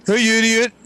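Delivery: high, mock-indignant kid; indignant retort. Retold quarrel at fountain.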